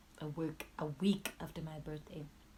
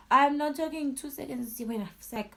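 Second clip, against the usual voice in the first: high and loud insistence; loud emphasis; 8 semitones higher, 10 dB louder than usual. Real, not acted. Telling viewers how long she was strangled, loud and insistent. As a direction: insist on it, raised.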